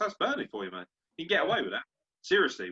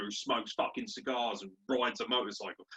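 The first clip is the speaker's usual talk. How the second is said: usual speaking voice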